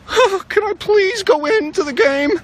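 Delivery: slow, high, loud whining plea; begging benched player. Pretend. Benched player begging the coach to play, whining. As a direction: beg the coach like a crying kid.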